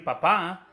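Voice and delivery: "papá" with the final á accented; stress on second syllable. Shown as a demonstration. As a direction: lean on the last syllable of "papá". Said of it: "lleva el acento en la segunda A". ("papá" con acento en la última sílaba)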